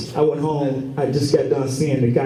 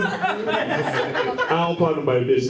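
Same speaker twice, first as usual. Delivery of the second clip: usual speaking voice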